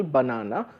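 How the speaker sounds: banana with the middle "na" stressed; stress on second syllable. Shown as a demonstration. Lecturer shows students the accent in banana, second syllable stressed. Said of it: "has an accent on the second syllable".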